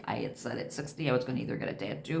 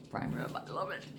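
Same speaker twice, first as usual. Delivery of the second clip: whispered craving; 6 semitones higher, 8 dB quieter than usual. Real, not acted. Savoring the meal plan.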